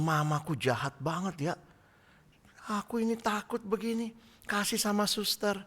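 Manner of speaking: put-on child's hurt thought after being handed to the nurse, soft and scared; frightened child thinking. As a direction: think it to yourself like a scared toddler.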